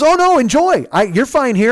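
high, loud, overdone reassurance; imitated nervous backpedalling. Old man backs down when challenged, nervously insisting. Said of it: "he's nervous because now".

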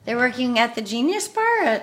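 mock-impressed sarcasm; teasing, put-on enthusiasm; sarcastic. Real, not acted. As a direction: say it with a sarcastic lilt. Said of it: "I say that sarcastically".

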